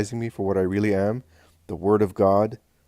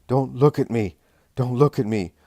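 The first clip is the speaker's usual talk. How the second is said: loud, high cry of panic; anguished shout; 5 semitones higher, 8 dB louder than usual. A narrator's reading. Man to Jesus, unable to bear his eyes, anguished shout. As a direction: shout it out, frantic to escape. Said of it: "shouts the man".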